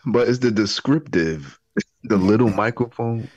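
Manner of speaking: high teasing voice. Pretend. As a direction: poke fun at the wording. Teasing Joe's description.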